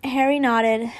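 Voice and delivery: plain reading voice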